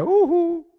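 high jeering hum; taunting rival's hum, loud; mocking ujú. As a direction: sneer it up high at her rival. Penina taunting Ana.

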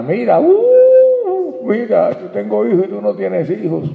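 high, loud sing-song jeer; a showy, mocking jab at a childless wife; mocking taunt. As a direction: taunt her like a gloating rival. Rival wife taunting childless Ana.

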